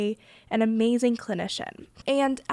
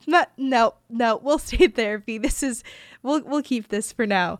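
retold nervous refusal, louder at the start; hasty refusal; 5 dB louder than usual. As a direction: act out hurriedly turning the idea down. Retold refusal to end therapy.

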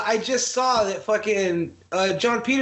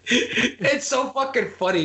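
ordinary delivery

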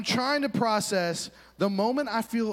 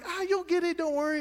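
high, sweet mock reassurance; condescending encouragement; 13 semitones higher than usual. Students patronizing the struggling skier, high mock sweetness. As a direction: coo it like talking to a child. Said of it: "they're like".

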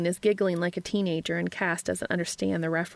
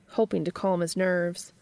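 ordinary delivery

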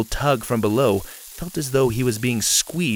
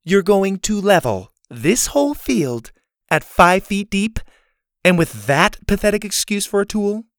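scornful disbelief; 7 semitones higher, 3 dB louder than usual. A narrator's reading. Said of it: "Laney raised an eyebrow".

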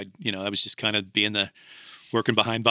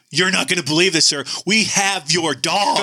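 excited producer; 7 semitones higher than usual. Retold or imitated. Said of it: "He's all excited about it".

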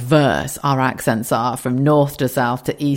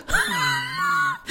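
high shrieking burst of laughter; 6 dB quieter than usual. Real, not acted. Vlogger laughing at calling herself a dog. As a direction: crack up at her own joke. Laughing at her own joke.

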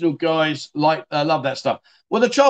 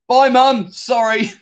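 loud, high bye; calling out; 6 semitones higher, 5 dB louder, much slower than usual. Real, not acted. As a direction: call out cheerily. Waving off mum on stream.

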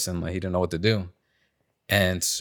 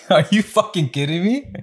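high, disbelieving retort; incredulous; 10 semitones higher than usual. Retold or imitated. Answering the coworker who turned it on him, incredulous. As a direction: retell it in pitched-up disbelief. Retold disbelief at being blamed.

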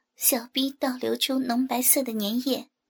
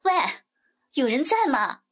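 high, pushy customer calling out; brash neighbourhood gossip's big voice; loud-mouthed woman; 3 semitones higher, 4 dB louder than usual. A narrator's reading. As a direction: holler impatiently with swooping pitch. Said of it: "标准高八度的大嗓门".

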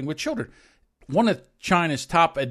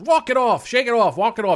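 loud, higher coaching shout; mock dad's orders; 7 semitones higher, 5 dB louder than usual. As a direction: imitate a dad shouting off the pain. Dad orders crying kid on.

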